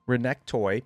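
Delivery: usual speaking voice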